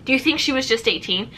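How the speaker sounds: normal talking voice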